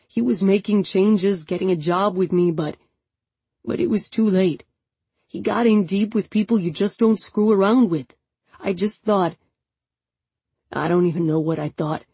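lightly voiced young man, heavy with grief; a hint of a tired, grieving friend. A narrator's reading. Hector's grief-laden speech.

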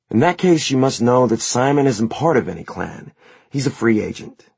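put-on confident, cool young woman; sharp young woman. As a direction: put the servant in his place. Isabel voiced higher than narration.

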